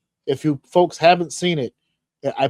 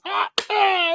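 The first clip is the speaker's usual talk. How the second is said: high, held imitation wrestler yell; high drawn-out "aah"; mock battle cry. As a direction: answer with a high-pitched war cry.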